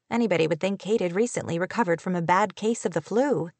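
usual speaking voice

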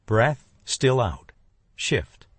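ordinary delivery, nothing marked

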